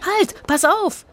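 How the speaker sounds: high startled "watch out"; warning cry. A narrator's reading. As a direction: pull him back with a sudden high alarm. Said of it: "hielt Zora ihn zurück".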